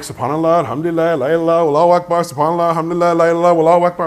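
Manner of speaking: busy, unbroken recitation on one note; rapid-fire dhikr. Retold or imitated. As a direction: run the phrases together in a quick chant.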